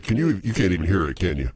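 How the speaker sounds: ordinary talk, nothing marked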